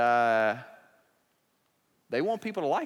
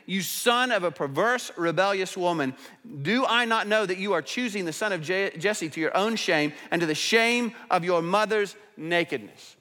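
raised, higher angry reading of King Saul; Saul's anger; 6 semitones higher, 4 dB louder than usual. Acted. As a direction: read it like a furious king berating his son. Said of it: "Then Saul's anger burned against Jonathan".

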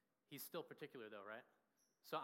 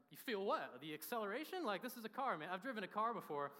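higher, louder, incredulous retort; retold exasperation at a fussy friend, half-joking; mock indignant; 5 semitones higher, 5 dB louder than usual. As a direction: play up the disbelief of a guy who can drive.

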